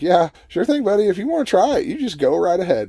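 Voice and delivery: high-pitched, teasing go-ahead; mocking amusement. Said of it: "he's laughing at me".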